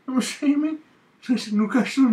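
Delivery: very high, loud Geordie caricature; Newcastle accent. Shown as a demonstration. Friend answering the impression with his own, shrieked Geordie accent. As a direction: draw it out in a squealed Newcastle voice.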